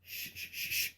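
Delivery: urgent whispered shush. Pretend. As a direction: hush him up fast. Hushing partner about grandma's necklace.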